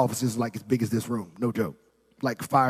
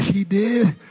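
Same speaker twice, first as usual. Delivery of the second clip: mock tearful surprise; 5 semitones higher, 4 dB louder than usual. Director hearing God told him to resign, mock tearful surprise. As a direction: imitate a tearful director, pitched up. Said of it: "she's crying. She's like".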